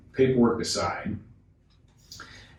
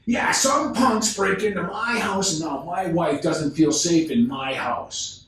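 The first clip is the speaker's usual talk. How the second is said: higher, raised voice, still heated; upset yell; 9 semitones higher than usual. Retold or imitated. Homeowner scolding the salesman over the break-in, heated. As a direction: let the old man's anger spill out. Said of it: "he yelled".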